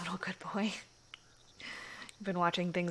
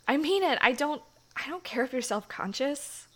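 higher, louder, pressing the point; emphatic heartfelt insistence; earnest insistence; 6 semitones higher, 7 dB louder than usual. Acted. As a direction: reassure him with urgency. Insisting after shy compliment.

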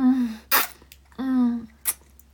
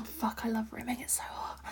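whispering; 10 dB quieter than usual. Acted. Whispered aside.

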